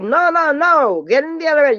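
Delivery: high-pitched excited insistence; excited insisting. Retold or imitated. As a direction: stop her leaving, all worked up. Sisters block her leaving.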